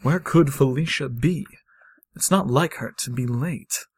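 soft-voiced, waiting doctor; understated worried professor voice; impatient doctor character. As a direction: wonder quietly to yourself, in character. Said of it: "Good voice, [name]".

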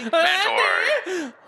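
high announcer call collapsing into laughter; cracking up. Real, not acted. Host botching the roster read, laughing. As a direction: lose it laughing mid-name.

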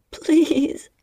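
whispered blubbering plea. Acted. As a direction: beg through tears. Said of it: "I said, blubbering".

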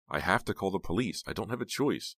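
insist, voice climbing in distress; upset insistence. Acted. Heather tells Snowman about the police, upset insistence.

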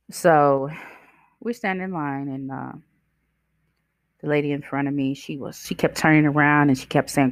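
usual speaking voice

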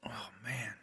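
a breathy whispered aside after the sip; whispered oh man. Real, not acted. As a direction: whisper it to yourself, savoring. Savoring a strong whiskey sip.

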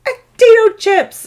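put-on high, loud squeal of toddler delight; excited child. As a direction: imitate a thrilled little kid. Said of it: "when she gets to be excited".

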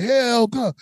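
high chanted name like a crowd; crowd screaming. Retold or imitated. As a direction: imitate a whole crowd chanting. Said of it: "the whole crowd started screaming".

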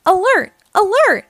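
high, loud repeated alert; urgent alarm call. A narrator's reading. Firehouse alarm warns the crew, urgent high call.

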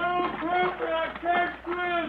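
scared plea. Acted. Captain in water, can't swim.

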